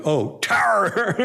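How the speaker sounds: high, drawn-out mock realization; sudden realization. Squirming devotee finally gets the guru's word, mock realization. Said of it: "So then when I finally get it".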